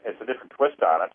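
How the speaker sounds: ordinary delivery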